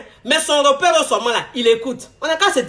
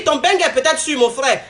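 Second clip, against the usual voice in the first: ordinary talk, nothing marked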